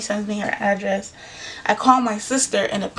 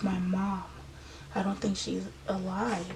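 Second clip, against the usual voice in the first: hushed grief; 10 dB quieter than usual. Retold or imitated. Telling boyfriend mom died.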